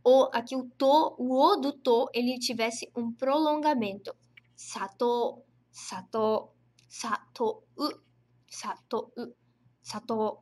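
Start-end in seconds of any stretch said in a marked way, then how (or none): 4.5-10.4 teacher models "sato" as spoken, long o; everyday pronunciation. Shown as a demonstration. Teacher showing students how "sato" really sounds. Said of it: "Viram a diferença?".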